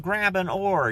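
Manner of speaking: high, mock-commanding call; a touch of a bossy rower. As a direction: toss off a lightly voiced order to row. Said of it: "they're like".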